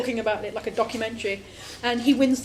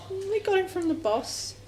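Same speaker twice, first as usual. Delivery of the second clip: sheepish underling; 8 semitones higher, slower than usual. Retold or imitated.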